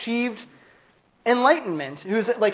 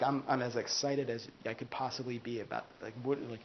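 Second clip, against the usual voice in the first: lower, much softer monotone; apathetic insistence; 7 semitones lower, 15 dB quieter than usual. Retold or imitated. Patient insisting they're excited, flat and quiet. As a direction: protest flatly without energy. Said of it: "And you're like".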